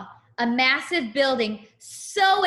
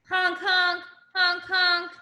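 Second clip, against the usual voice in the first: higher sing-song honks; honking horn demo; 5 semitones higher than usual. Shown as a demonstration. Reader showing children how to honk, higher voice. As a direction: honk like a truck horn for the kids. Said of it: "Show me how you honk your horn".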